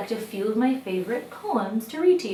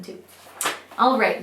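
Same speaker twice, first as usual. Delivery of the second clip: usual speaking voice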